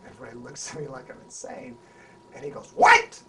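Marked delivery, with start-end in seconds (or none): 2.8-3.2 loud startled retort; startled what. Retold or imitated. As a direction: snap back caught off guard. Hoffman's startled reply.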